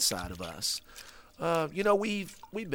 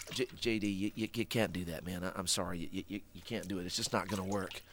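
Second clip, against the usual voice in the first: quiet, gentle refusal; soft let-down; 7 semitones lower, 9 dB quieter than usual. Real, not acted. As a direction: let him down softly. Gently refusing, much softer.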